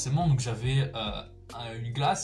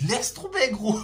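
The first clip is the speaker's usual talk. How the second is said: laughing; 9 semitones higher, 4 dB louder than usual. Real, not acted. Recalling burning pain, laughing.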